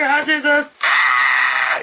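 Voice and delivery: pained reaction. Retold or imitated. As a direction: cry out as if you felt it. Re-enacting own pained reaction.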